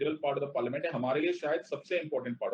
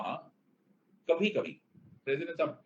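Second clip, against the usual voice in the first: ordinary delivery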